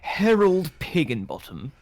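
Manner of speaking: put-on British accent. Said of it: "turn British again".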